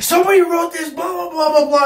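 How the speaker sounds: high-pitched mock yell; ranting teacher. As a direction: imitate an outraged teacher ranting away.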